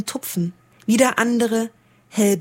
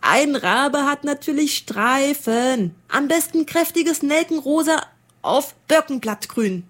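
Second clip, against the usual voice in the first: haughty striped raven; 6 semitones higher than usual. Acted. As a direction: declare it as settled fact. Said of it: "krächzte ein rosagrün gestreifter".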